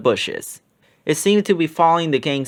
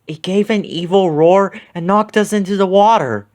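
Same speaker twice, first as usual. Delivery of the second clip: lightly voiced girl, higher; a hint of a shaken camper; Gemma's voice; 5 semitones higher, 3 dB louder than usual. A narrator's reading. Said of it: "Gemma said".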